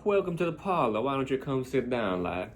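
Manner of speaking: genteel mock Southern host; Southern drawl. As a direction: welcome guests in a plantation drawl. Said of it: "they probably still talk like that".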